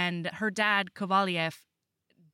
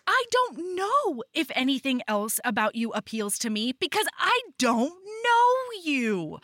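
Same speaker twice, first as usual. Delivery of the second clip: much higher, slowed, exaggerated outrage; mock indignant heroine; 11 semitones higher than usual. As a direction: ham up her frustration in a high voice. She's like.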